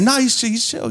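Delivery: imitating a friend in a high voice; high, squeaky brush-off, played for laughs; high-pitched reassurance. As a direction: wave off the worry in a mock high voice. Retold friend waving off the danger.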